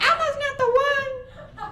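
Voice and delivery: high, loud squeaky puppet threat; mock tough threat. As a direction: shriek it like a puppet picking a fight. Sesame Street gang threat.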